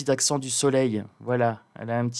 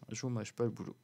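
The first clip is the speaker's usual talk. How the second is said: much softer, half under the breath; quiet aside; 12 dB quieter, faster than usual. Real, not acted. Vlogger repeating the line to viewers as a soft aside. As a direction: drop the repeat "Je vous mâche pas le boulot" to a murmur.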